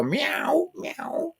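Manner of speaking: high squeaky cat meow. Retold or imitated. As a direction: mimic the cat's little meows. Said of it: "everybody can hear you go".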